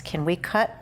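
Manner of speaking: ordinary talk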